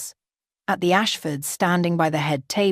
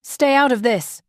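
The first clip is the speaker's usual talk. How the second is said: higher, louder snarl; 7 semitones higher, 4 dB louder than usual. A narrator's reading. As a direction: snarl it, hostile. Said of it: "Trevor snarled".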